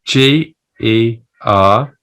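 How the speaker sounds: usual speaking voice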